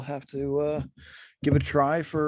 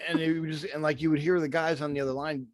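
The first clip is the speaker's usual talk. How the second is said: ordinary talk, nothing marked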